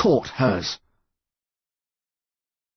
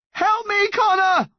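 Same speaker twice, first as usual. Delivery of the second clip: high-pitched cry for help; desperate yell; slower than usual. A narrator's reading. Mum clinging to her son over a cliff, yelling. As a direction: yell it out in panic. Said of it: "his mum yelled".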